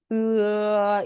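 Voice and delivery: "으" drawn out, opening slowly into 어; gliding vowel. Shown as a demonstration. Teacher showing students the vowel, "으" slowly gliding to 어. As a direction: say "으" long, slowly widening from 으 towards 어. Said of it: "입을 으에서 어로 천천히 벌리면서".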